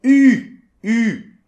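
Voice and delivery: "U" high and loud, lips rounded as for OU with tongue set for I; French U. Shown as a demonstration. Teacher loudly demonstrating the French U. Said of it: "comme le OU, et vous essayez de faire un I".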